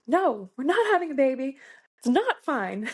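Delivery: mock shout from another room; shouting back. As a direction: call it back mid-labour, insistent. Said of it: "shouting back".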